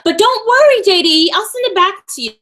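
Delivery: high, loud, sarcastic don't worry; sarcastic comfort to JD; mock reassurance. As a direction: pretend to soothe him while mocking.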